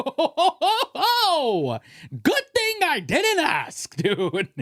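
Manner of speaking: high, laughing, slow mock relief; laughing sarcasm. Real, not acted. Laughing at a girl who says she has a boyfriend, high sarcasm.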